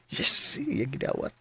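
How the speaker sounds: slow giddy whisper to himself; whispered aside. Acted. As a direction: whisper it slowly, thrilled. Aside after she accepts coffee. (hinagawhaw nga kalipay)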